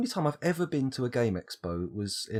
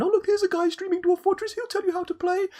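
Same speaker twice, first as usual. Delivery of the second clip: very high, eager mock-cheerful voice; high excited voice; 5 dB louder than usual. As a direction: squeak it out brightly like a kid. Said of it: "you find".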